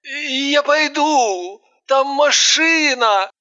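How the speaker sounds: slow begging, high; pleading. A narrator's reading. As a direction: plead to leave. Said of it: "тут же взмолился".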